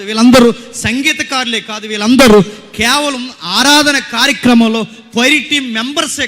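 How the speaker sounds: high, loud sermon voice; fervent preaching. Acted. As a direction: preach it out to the whole crowd. Preaches at full force. (ఆవేశంగా, గట్టిగా ప్రసంగం)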